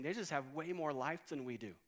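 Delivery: usual speaking voice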